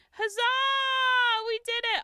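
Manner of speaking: high, loud, drawn-out huzzah; triumphant cheer. Real, not acted. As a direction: celebrate finishing at last. Celebrates finishing the build.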